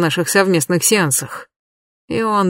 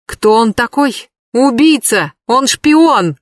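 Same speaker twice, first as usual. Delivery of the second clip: angry shout; 5 semitones higher, 4 dB louder, slower than usual. Acted. Katya shouting angrily in car.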